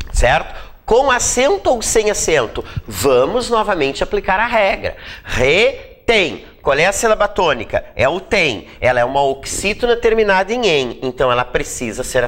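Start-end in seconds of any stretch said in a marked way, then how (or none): 5.2-6.4 "Retém" in two separated pieces; syllable by syllable. Shown as a demonstration. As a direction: sound out "Retém" as re, pause, tém. Sounding out syllables to find stress.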